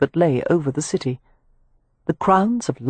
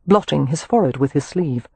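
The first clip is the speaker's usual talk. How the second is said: ordinary delivery, nothing marked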